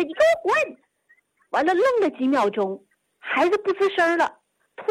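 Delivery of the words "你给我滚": high, shrill angry yell; angry get out. Retold or imitated. Grandma orders grandson out, high furious yell. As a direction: bark the order at the child. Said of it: "他奶奶一告他".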